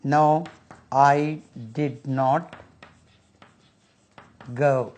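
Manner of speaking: very slow, each word apart; slow classroom model of I did not go; slow model sentence. Shown as a demonstration. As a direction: drill it slowly for the class. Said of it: "नहीं गया।".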